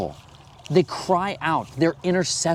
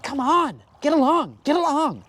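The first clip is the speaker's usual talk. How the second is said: high-pitched mock parental pleading; exasperated pleading; 11 semitones higher than usual. Parent begging fighting children to get along, exasperated. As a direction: urge them in a raised, worn-out parent's voice.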